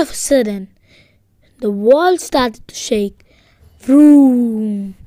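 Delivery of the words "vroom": loud, drawn-out engine vroom; booming vroom, held long; motorcycle roar. A narrator's reading. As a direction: imitate a roaring motorbike engine. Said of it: "A loud roaring sound".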